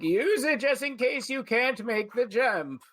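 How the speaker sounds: calling across. Acted. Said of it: "and be like".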